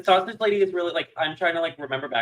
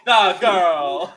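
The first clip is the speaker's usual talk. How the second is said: loud, higher Kent accent call; 4 semitones higher, 9 dB louder than usual. Shown as a demonstration. Repeating her party-piece phrase for the co-hosts, Kent accent. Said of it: "one thing in a Kent accent".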